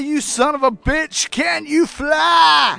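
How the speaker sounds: taunting shout. Retold or imitated. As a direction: scream the dare at the monster. Quoting the famous taunt.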